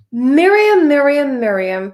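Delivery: loud call of the name, fading with each repeat. Real, not acted. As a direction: call Miriam as if across a room, dropping off. Calling the dictionary voice Miriam.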